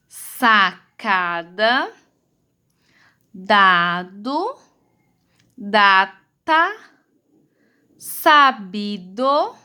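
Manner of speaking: very slow, each syllable held and separated; leitura por sílabas. Shown as a demonstration. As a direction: read out each word syllable by syllable. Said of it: "nós vamos ler as palavras". (leitura silabada, bem devagar)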